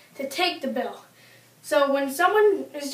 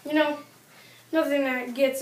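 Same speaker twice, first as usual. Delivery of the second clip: ordinary talk, nothing marked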